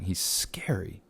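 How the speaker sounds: soft breathy whisper, almost to yourself; hushed whisper. Pretend. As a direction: whisper it softly, like a secret. Spooky aside about chilling villain.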